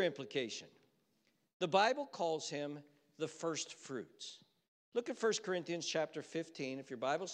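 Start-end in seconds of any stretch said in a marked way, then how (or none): none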